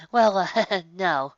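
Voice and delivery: sheepish little laugh; embarrassed admission, laughing it off. Acted. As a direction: caught out, giggling sheepishly. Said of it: "Twilight conceded a bit sheepishly".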